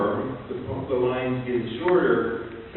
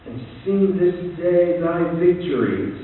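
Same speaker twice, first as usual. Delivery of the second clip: slow, louder, exultant recital; poem reading; 5 semitones higher, 6 dB louder than usual. Acted. Lecturer reciting Easter victory, slow and triumphant. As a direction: sing out the victory line.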